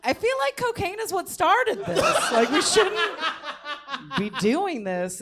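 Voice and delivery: high, laughing inner voice; inner worry. Retold or imitated. Offered cocaine at the apartment, high laughing inner voice. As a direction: giggle out the thought. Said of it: "in my head".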